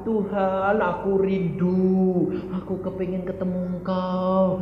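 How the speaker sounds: lightly voiced yearning believer; mock longing prayer. Preacher mimics healthy believer praying, mock longing. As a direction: draw it out like a pining plea.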